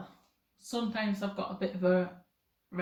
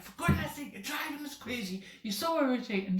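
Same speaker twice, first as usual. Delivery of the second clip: mock exasperated outburst, high-pitched; flipping your lid; 6 semitones higher than usual. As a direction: play at storming off, irritated and higher. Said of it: "flipping your lid and be, like, storming off".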